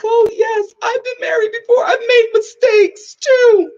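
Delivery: high, loud hammed-up wailing; mock tearful confession. Overwhelmed guest confessing to dad, mock wailing. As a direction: bawl it out, dragging the end.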